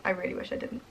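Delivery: normal talking voice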